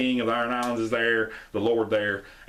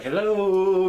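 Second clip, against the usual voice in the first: high, louder hello into the dark; calling hello; 10 semitones higher, 6 dB louder than usual. Retold or imitated. Tyrion greeting the dragons in the dungeon, high call. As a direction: call out gently to the beasts.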